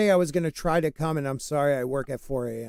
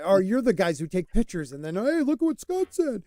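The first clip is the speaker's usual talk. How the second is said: mock gawker, pitch jumping high at the end; imitazione beffarda; 4 semitones higher than usual. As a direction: put on an excited tattletale's higher voice. Mocking imitation of picture-takers.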